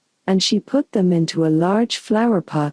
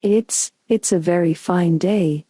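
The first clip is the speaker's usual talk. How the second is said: ordinary delivery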